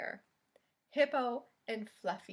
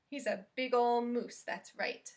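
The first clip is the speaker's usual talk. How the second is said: ordinary delivery, nothing marked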